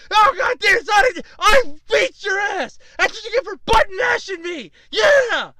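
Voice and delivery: high, loud mock-furious yelling; hammed-up angry triumph; pissed-off gloating. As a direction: imitate an enraged gamer rubbing in his win. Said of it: "He's pissed off at this point".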